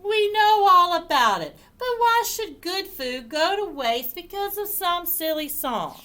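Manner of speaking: high, protesting rat voice; high-pitched rat objection; exasperated rat. Acted. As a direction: argue back loudly, then ease off. Rat argues with the dog.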